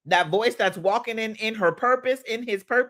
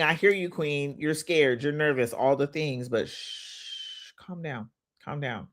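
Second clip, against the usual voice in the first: soft, softer soothing hush; calm the scared inner voice tenderly; soothing self-talk; 5 semitones lower, 5 dB quieter, slower than usual. Shown as a demonstration. Coach soothing her own nervous mind, softly. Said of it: "I just shush her up".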